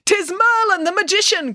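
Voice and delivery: high excited cry; cried out. A narrator's reading. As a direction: announce Merlin from the crowd. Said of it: "cried a voice from the crowd".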